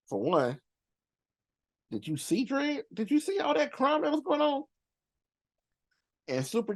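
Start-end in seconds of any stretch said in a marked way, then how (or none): 1.9-4.6 high-pitched disbelieving questions; can you believe it, voice climbing high; incredulous. Real, not acted. Incredulous about the film's crime.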